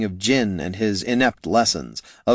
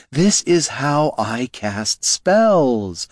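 slow, pointed insistence; defiant; 4 semitones higher, much slower than usual. Acted. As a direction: drag it out stubbornly. Loruna defends her method.

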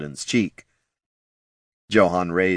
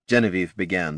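ordinary delivery

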